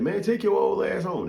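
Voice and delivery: scornful mock heckle, high voice; mock dismissal. Mock dismissal from young guys.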